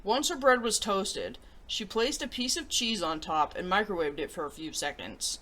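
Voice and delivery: put-on reading a picture book to children; storybook read-aloud voice; story narration. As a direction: tell it gently to young listeners.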